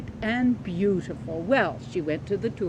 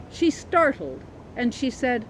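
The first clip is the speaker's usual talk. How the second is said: ordinary delivery, nothing marked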